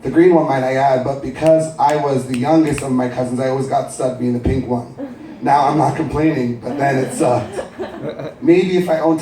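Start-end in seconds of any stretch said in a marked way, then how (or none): none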